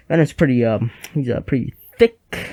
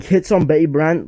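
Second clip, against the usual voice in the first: usual speaking voice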